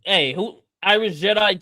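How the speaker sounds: ordinary talk, nothing marked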